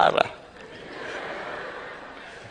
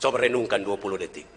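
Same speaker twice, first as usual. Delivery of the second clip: ordinary talk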